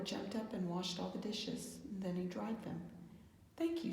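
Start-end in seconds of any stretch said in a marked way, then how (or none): 3.6-3.9 smiling. A narrator's reading. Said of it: "she said, smiling".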